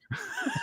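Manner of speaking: short laugh. Real, not acted. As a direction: burst out laughing. Laughs at own misspelling.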